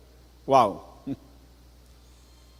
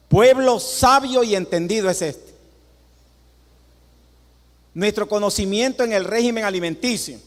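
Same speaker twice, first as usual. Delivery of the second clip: proud proclamation; 5 semitones higher, 4 dB louder than usual. Real, not acted. Proud declaration to congregation.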